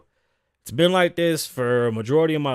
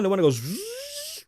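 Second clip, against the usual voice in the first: much higher buzzing machine sound; imitated ride noise; 3 dB quieter than usual. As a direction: buzz like a spinning fairground ride.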